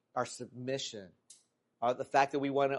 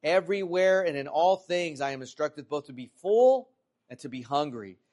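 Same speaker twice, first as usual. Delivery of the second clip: emphatic scripture reading with swelling key words; 2 semitones higher, 5 dB louder than usual. Acted. Preacher reads Philippians to congregation, emphatic scripture reading. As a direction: read the verse out firmly to a congregation.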